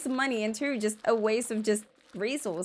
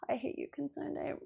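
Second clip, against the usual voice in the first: whispered grudge; 3 semitones higher, 11 dB quieter than usual. Real, not acted. Grumbling at the game developer.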